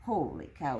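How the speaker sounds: ordinary talk, nothing marked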